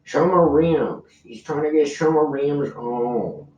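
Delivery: high mocking baller voice. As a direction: say it like your mouth is full of gold. Mocking rich guy voice.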